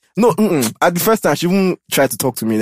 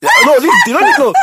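squealing protest; 6 dB louder than usual. Real, not acted. Flustered protest, very high.

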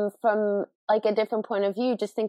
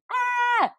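mock scream. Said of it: "Owen starts going".

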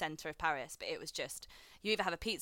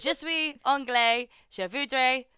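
put-on high, loud, slow French accent; 5 semitones higher, 8 dB louder than usual. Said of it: "I love how you kept the accent".

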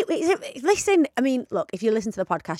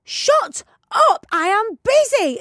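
much higher, slow, louder snap back; mock yell; 10 semitones higher, 3 dB louder, much slower than usual. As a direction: shout back while busy on a call. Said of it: "And I was like".

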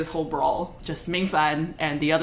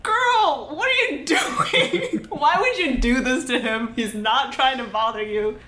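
high, louder laughing disbelief; exasperated onlooker; 8 semitones higher, 4 dB louder than usual. Retold or imitated. Onlooker scolding the girl, high and laughing. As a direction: squeal it in amused horror. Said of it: "Shen Qingqiu's like".

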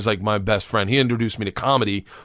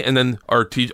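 normal talking voice

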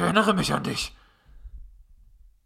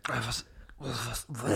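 shocked whisper; 8 semitones lower, 13 dB quieter than usual. Acted. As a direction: whisper in confusion. Creature confused.

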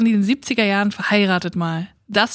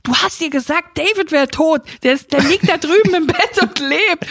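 higher, louder, accusing; angry confrontation; 6 semitones higher, 3 dB louder than usual. Retold or imitated. A housemate confronts Angie, angry and louder. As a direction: tell her off for the false alarm. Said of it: "mega sauer".

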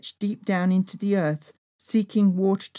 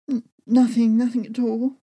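tearful denial; 4 semitones higher, 5 dB louder than usual. Acted. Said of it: "dabbing my tears".

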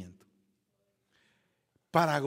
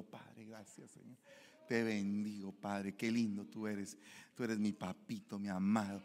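soft, intimate worship; tender prayer; 4 semitones lower, 6 dB quieter than usual. Retold or imitated. A lightly voiced driver praying tenderly to God. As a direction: pray softly like in the car.

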